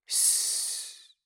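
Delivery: soft drawn-out "shh"; shushing. Acted. Wife hushing her husband, a soft shush. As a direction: hush them with a quiet shh.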